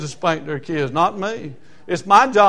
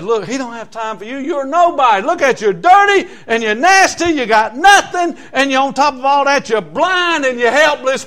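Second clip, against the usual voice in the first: mocking crowd; 7 semitones higher, 7 dB louder than usual. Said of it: "looked at him and said".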